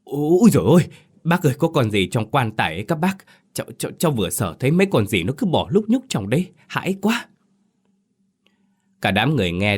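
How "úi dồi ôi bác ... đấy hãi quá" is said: high panicked yelp, fast stammering; man fallen by the coffin cries out in fright, high and panicked; terrified cry. A narrator's reading. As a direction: shriek and babble in terror. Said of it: "rú lên rồi ngã ra đất ú ớ kêu".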